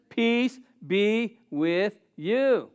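very slow, gentle "Peace be with you"; calm blessing. Acted. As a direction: soothe frightened friends. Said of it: "He just says".

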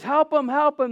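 high-pitched retold plea for help; urgent call. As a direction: imitate summoning rescuers. Sister retold calling for rescuers.

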